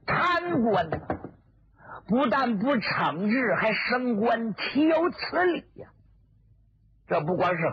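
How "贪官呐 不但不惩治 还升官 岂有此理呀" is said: higher, anguished outrage at corruption; indignant outburst. Acted. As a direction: cry out against the injustice. Indignant rant at corrupt officials.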